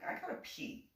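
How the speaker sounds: hushed, voiceless aside; retold whisper. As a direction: whisper it as an awkward little excuse. Retold awkward exit to pee.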